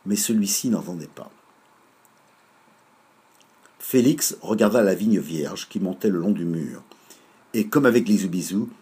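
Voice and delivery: plain reading voice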